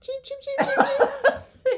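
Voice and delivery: high squeaky chick cheeps; baby chick peeps. Pretend. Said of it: "See, it works perfectly".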